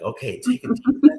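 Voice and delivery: high, soothing meditation-tape voice; imitating a guided meditation recording; mock meditation tape. Imitating meditation tapes to the host, a high soothing guide voice. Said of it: "those meditation tapes are like".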